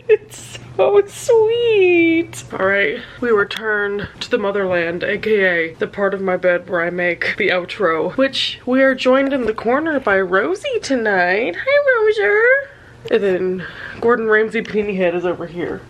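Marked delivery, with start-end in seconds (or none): 0.1-2.4 high, slow tearful squeak; crying. Real, not acted. Still crying over the book, tearful. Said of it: "I'm a wreck". 11.6-12.7 high, slow cooing; baby talk to pet. Acted. Greeting the dog Rosie in baby talk.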